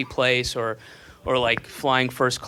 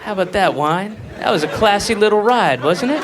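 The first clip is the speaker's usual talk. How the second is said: talking to the wine; 6 semitones higher, 4 dB louder than usual. Pretend.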